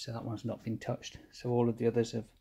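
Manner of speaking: usual speaking voice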